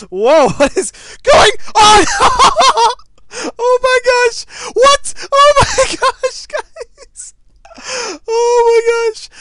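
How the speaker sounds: screaming high and loud, breaking into laughter; real shrieking, laughing astonishment; hysterical excitement. Real, not acted. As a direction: yell in disbelief at a huge win. Huge unexpected kill in game.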